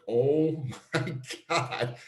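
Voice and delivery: "oh my God" drawn way out, high; retold shock, stretching every word slowly; high, very slow exclamation of dismay; stunned disbelief. Retold shock at seeing the trade.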